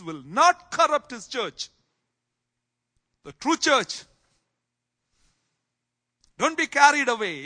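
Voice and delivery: loud, high preaching fervor; full-force preaching. Acted. Pastor exhorting church to stay true, full preaching force. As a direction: preach it out hard and loud to the flock.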